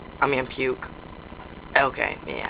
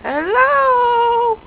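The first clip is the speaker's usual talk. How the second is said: long, high drawn-out hello; calling out; 14 semitones higher, much slower than usual. Pretend. Player calling into an unknown house, high drawn-out call. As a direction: sing out high to find who is there.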